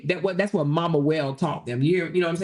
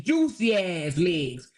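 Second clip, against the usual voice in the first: drawn out; much slower than usual. Acted. Savoring her juicy legs.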